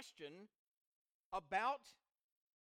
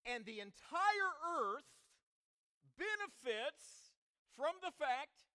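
loud, raised-pitch sermon delivery; emphatic preaching; 5 semitones higher, 9 dB louder, slower than usual. Real, not acted. Preacher on the gift to the entire earth, emphatic and loud. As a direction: drive each phrase home with pauses between.

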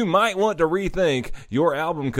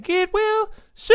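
very high, drawn-out squeal on the last word; mock the song with a piercing, stretched high note; ear-hurting screech. Host mocking the singer's ear-splitting track, high screech. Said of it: "That's what it sounds like. It hurts your ears".

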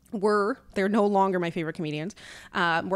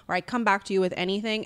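usual speaking voice

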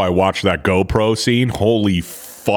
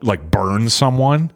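ordinary talk, nothing marked

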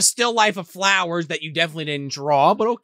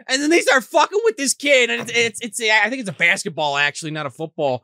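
ordinary talk

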